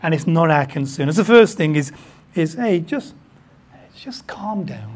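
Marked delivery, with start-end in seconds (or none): 2.5-5.0 slow whisper of calm down; hushed slow reassurance. Acted. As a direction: breathe it out to soothe them. Soothing congregation.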